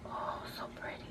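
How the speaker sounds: hushed, admiring whisper. Real, not acted. Admiring a swatch to viewers, soft whisper. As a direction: breathe it like a secret.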